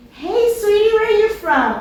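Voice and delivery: high, honeyed welcome to a stranger; sweet friendly greeting. Acted. Preacher voicing a sister greeting a newcomer, sugary and high. Befriending woman at a gathering.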